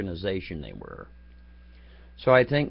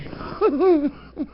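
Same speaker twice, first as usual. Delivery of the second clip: high giggle; 4 dB louder than usual. Real, not acted. Laughs mid story.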